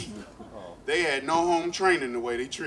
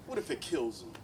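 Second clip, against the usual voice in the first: ordinary talk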